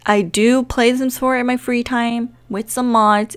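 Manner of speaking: ordinary talk, nothing marked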